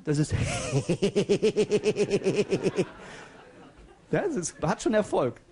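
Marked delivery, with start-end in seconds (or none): 0.4-2.8 goat-like bleating cackle; bleating laugh. Retold or imitated. Comedian mimics Mario Barth's bleating laugh. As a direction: bleat out a mocking stuttered laugh. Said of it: "kennen Sie dieses Meckern?".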